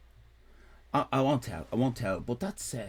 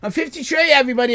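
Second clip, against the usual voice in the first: excited announcement; 11 semitones higher, 9 dB louder, much faster than usual. Real, not acted. Announcing his age to chat.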